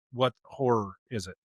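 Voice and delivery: usual speaking voice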